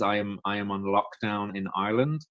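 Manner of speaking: usual speaking voice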